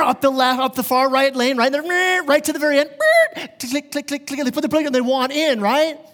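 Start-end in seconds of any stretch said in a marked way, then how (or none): 3.0-3.9 high-pitched engine buzz then tongue clicks; car zoom and clicks. Retold or imitated. Preacher acting out a car cutting in, buzz and clicks.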